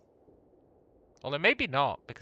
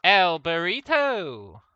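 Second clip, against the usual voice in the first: slow, loud drawn-out announcement; announcer voice; 3 semitones higher, 7 dB louder, much slower than usual. Pretend. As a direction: stretch the name out grandly. Announces character name dramatically.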